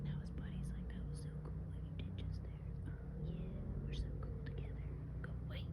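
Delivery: annoyed hushed whisper. Real, not acted. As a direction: whisper bitterly at the hunters. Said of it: "why do I have to whisper".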